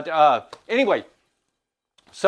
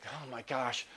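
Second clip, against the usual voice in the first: frustrated; 3 semitones lower, 14 dB quieter than usual. Retold or imitated. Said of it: "[name] gets frustrated".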